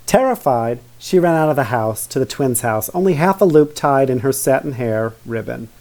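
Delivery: ordinary delivery, nothing marked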